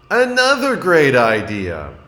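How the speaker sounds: put-on high, loud little-boy voice; a child showing his point; young Franklin, rueful. A narrator's reading.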